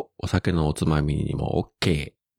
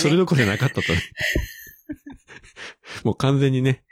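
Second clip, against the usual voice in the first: laughing; 6 semitones higher, faster than usual. Real, not acted.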